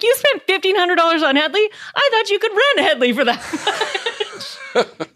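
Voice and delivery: high, slow squeaky woman's voice; high mock receptionist voice. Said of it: "she was like".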